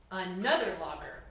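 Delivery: plain reading voice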